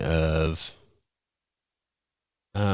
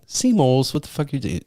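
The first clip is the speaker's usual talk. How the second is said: incredulous; 7 semitones higher than usual. Retold or imitated. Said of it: "I said".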